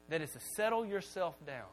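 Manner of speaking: usual speaking voice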